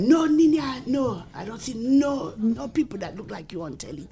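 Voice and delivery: strict parent's Dominican accent, acted out. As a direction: scold like Dad in a Dominican accent. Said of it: "Oh, yeah, from Dominica".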